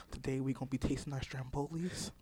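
very soft, low, close-mic murmur; mock-ASMR food intro. Shown as a demonstration. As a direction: play at ASMR, hushed and unhurried. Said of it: "Jump Off Point A S M R".

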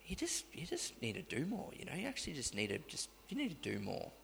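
hushed, needling accuser; the accusing devil on the phone to Liam, hushed; soft accusing voice. Retold or imitated. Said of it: "It's like".

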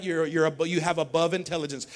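usual speaking voice

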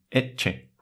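eche with a ch sound; Latin double C as ch. Shown as a demonstration. Showing CC before E as ch. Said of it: "una doble C seguida de la E".

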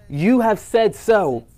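lightly voiced Jesus, slow and grave; slow solemn reply. Jesus answering Pilate's question, slow and grave. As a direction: let each word land, unhurried.

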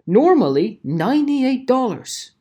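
loud and high mock ad read; caps ad voice. Mocking a shouty online ad to viewers, loud high read. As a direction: blare it like a banner ad. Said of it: "today with caps".

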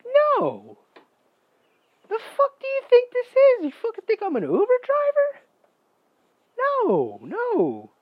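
high, loud indignant squawk; over-the-top yelling, voice shooting way up; outraged mock screech. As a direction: shriek the refusal in exaggerated outrage. Acted refusal to drive her.

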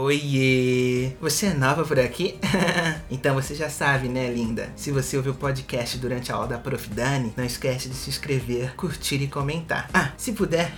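lightly flirty low influencer voice; a hint of smooth-talking TikTok charmer; TikTok seducer voice. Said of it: "bora ouvir o recadinho dele".

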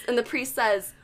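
ordinary delivery

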